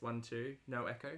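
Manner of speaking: normal talking voice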